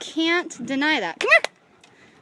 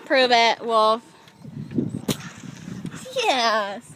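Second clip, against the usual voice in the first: normal talking voice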